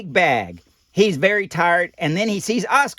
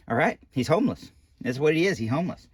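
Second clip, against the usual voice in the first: ordinary delivery, nothing marked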